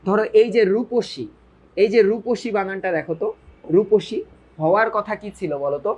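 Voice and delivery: ordinary delivery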